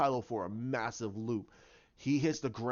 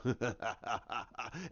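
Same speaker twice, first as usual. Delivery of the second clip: staccato mocking laugh, softly done; imitated gloating cackle; villain laugh; 3 semitones lower, 7 dB quieter than usual. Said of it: "He's a".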